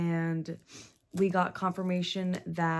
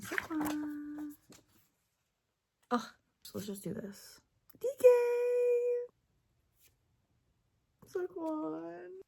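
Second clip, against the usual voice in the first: high cooing; 11 semitones higher, slower than usual. Real, not acted.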